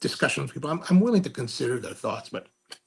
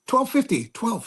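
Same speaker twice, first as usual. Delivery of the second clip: a hint of the market lady, pitched higher; seller answering; 8 semitones higher than usual. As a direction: lightly voice the lady's flat reply.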